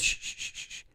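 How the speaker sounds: soft, repeated voiceless shushes; imitated shushing. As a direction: imitate a man hushing her, trailing away. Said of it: "he says".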